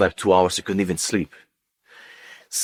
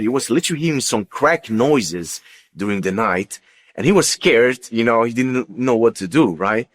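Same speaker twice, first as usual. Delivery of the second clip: usual speaking voice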